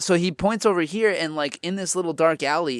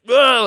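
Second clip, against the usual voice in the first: loud, high mock growl of rage; imitated angry roar; 10 semitones higher, 11 dB louder than usual. Angry stranger rages at the storyteller, imitated roar. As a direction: roar wordlessly like an enraged man. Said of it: "like, really angry shit".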